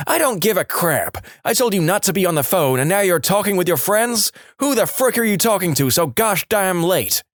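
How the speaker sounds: a retold scolding, lightly voiced; angry mum. Mum scolding her son for late phone call, mild anger.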